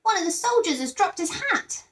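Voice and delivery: put-on high child voice; high, childlike exclaim; small boy. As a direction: blurt it out like an eager little boy. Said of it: "said a small boy".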